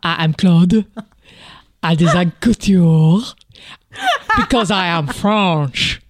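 put-on loud, deliberate French accent. Co-host plays designer Claude, deliberate loud French accent. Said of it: "French on".

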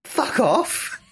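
high, astonished "fuck off"; stunned disbelief. Retold or imitated. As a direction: mimic her shocked reaction to the ring. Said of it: "she just goes".

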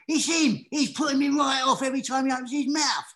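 loud, high mock rant; imitated exasperated outburst. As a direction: explode in comic exasperation at a colleague. Retold actor's angry outburst.

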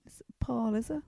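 hushed, unvoiced guessing at names; soft mock medium whisper; whispered psychic guess. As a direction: whisper it like a medium fishing for a name. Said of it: "She's like".

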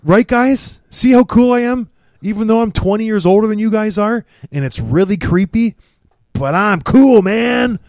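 high, loud needy bragging; mock show-off. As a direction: beg the room to call you cool. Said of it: "how cool J R is".

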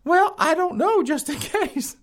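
high falsetto, hammed-up sweet-talking girlfriend; sheepish girlfriend. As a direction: coo like a caught-out girlfriend in a squeaky voice. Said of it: "girlfriend answers boyfriend".

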